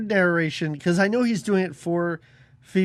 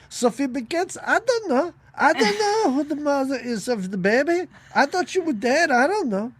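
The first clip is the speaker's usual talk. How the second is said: put-on higher, flustered old lady insisting; mock Angela Lansbury; 7 semitones higher than usual. Podcaster mimics Angela Lansbury denying she knows. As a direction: play the dying old woman denying everything. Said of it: "Angela Lansbury being like".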